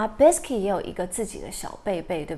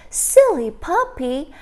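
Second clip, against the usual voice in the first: put-on little girl scolding; 5 semitones higher, 6 dB louder than usual. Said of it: "little girl就说什么".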